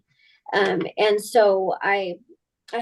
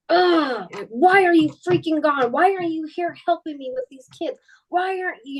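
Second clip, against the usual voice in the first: mock angry outburst, higher; angry at him; 6 semitones higher than usual. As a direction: vent at the absent husband, lightly. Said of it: "I'm just like".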